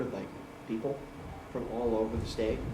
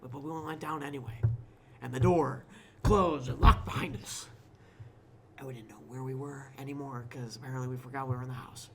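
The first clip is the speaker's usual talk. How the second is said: spooky story narration; 9 dB louder than usual. Acted.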